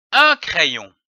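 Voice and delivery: "Un crayon" said smoothly without a break; "Un crayon" as one connected word; whole word joined. Shown as a demonstration. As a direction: blend the two halves of "crayon" together. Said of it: "donne le mot".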